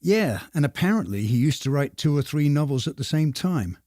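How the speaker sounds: normal talking voice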